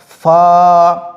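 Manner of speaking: "फा" held long on a level tone; pronunciation demo. Shown as a demonstration. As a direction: stretch the vowel of "फा" evenly. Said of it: "ये कैसे पढ़ा जाएगा". ("फा" को खींचकर पढ़ें)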